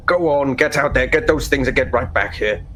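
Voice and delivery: a hint of an impatient overseer; waving them off, lightly voiced; mild brusque order; dismissive father. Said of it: "waves with one hand".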